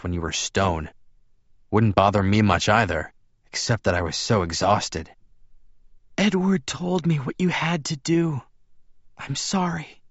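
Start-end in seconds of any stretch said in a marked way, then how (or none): 6.2-10.0 high frail woman's voice, apologetic; Bella. A narrator's reading. Bella apologises to her visitor, high frail voice. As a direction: apologise in a high, weak character voice.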